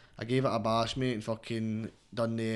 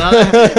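loud high cackle of a laugh; sudden loud laugh at his own cheekiness; burst of laughter; 10 semitones higher, 21 dB louder than usual. Real, not acted. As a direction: let a big laugh burst out.

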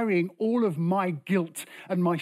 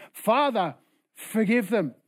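raised, louder plea to the Father; insistent prayer; 4 semitones higher, 4 dB louder than usual. Acted. Jesus insisting on forgiving those hurting him, raised prayer. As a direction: pray it firmly over the objections. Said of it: "Jesus prayed".

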